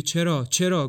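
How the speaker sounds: insistent. A narrator's reading. Julian protesting insistently.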